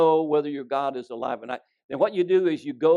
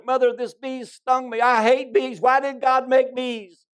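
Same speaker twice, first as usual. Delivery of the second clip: put-on high, loud whiny child's complaint, slowing at the end; crying little boy; 9 semitones higher, 6 dB louder than usual. Stung little boy crying to his mother, high and loud. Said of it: "he's crying to his mother".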